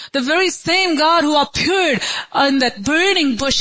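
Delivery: high, ringing altar-call appeal; full-force preaching. Real, not acted. Preacher asking the congregation if they will go, impassioned call.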